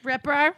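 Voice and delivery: "Ripper" with an R stuck on the end; New York intrusive R. Retold or imitated. Mimicking mom's name for Ripa, "Ripper" ending in an R. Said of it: "It's such a New York thing".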